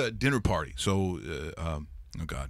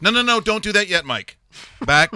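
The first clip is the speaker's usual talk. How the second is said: scolding host; 8 semitones higher, 7 dB louder than usual. Retold or imitated. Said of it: "they're going".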